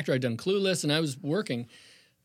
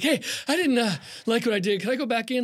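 out of breath; 6 semitones higher than usual. Retold or imitated. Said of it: "and I was out of breath".

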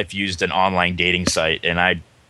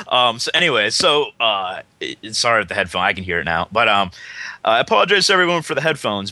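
ordinary talk

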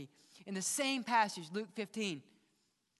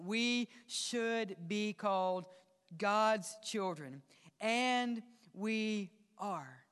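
slow and deliberate; much slower than usual. Real, not acted. Slow emphatic scripture reading.

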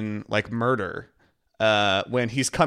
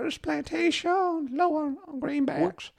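high, slow put-on Southern accent; mock Southern accent; 4 dB quieter, slower than usual. Host imitating Oscar's reluctant Southern accent for Michael, high and slow. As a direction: ham up a Southern accent half-heartedly. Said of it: "try to do an accent".

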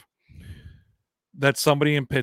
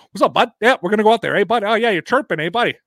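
higher, louder hammed-up locker-room chirping; Canadian hockey player; 9 semitones higher, 7 dB louder, faster than usual. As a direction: ham up a hockey bro hyping his buddy. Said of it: "welcome to Canadian hockey players".

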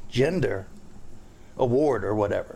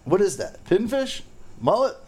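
high-pitched, nosy questioning in mimicry; 7 semitones higher than usual. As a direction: mimic a scrounger fishing for information, pitched up. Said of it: "it's all about probing you".

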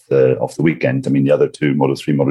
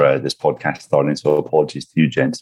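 normal talking voice

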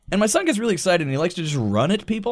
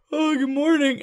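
high, light morning greeting; cheery wife; 11 semitones higher than usual. Retold or imitated. Wife greets frazzled husband downstairs, cheery imitation.

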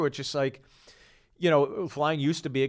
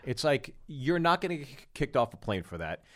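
normal talking voice

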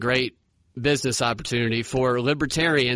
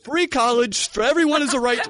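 higher mock Bernie, laughing through it; pitched-up Bernie with a laugh; Bernie Sanders impression; 9 semitones higher, 4 dB louder than usual. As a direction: answer the time question with the slogan. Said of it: "Bernie, what time is it?".

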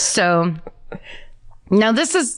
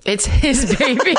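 laughing; 6 semitones higher than usual. Retold or imitated. Mocking the boyfriend's retort.

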